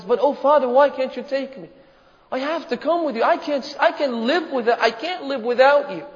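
acted plaintive child's plea; tearful pleading. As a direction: beg father not to leave, lightly acted tears. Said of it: "she begins to cry and says".

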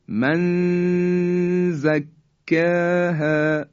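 من زکاها with the nun hidden, nasal hum held slow; ikhfa of nun. Shown as a demonstration. Teacher demonstrating ikhfa slowly.

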